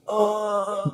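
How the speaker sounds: high, soft mock moan; imitated moan. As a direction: let out a feeble high groan. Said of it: "moaned when she hit the top".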